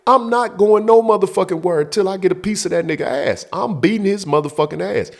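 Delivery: high, heated stand-your-ground rant; acting out a furious refusal; angry refusal. Acted refusal to leave.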